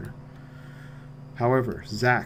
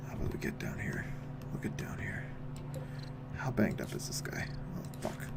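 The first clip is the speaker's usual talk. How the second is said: hushed unvoiced whisper; 3 semitones lower, 14 dB quieter than usual. Real, not acted. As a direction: whisper so the beast won't notice. Hiding close to the creature.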